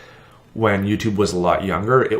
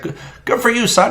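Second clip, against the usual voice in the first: put-on higher, friendly old man; old man's voice; 13 semitones higher than usual. Passing old man greeting vlogger, lightly voiced. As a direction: play a chatty old neighbor. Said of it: "he's like".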